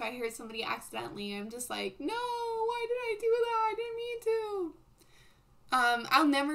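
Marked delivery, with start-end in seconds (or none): 2.0-4.7 high, whiny pretend crying; mock wailing. Imagining having hurt somebody accidentally, mock wailing. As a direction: wail it in a much higher, tearful put-on voice. Acts out guilt at hurting someone.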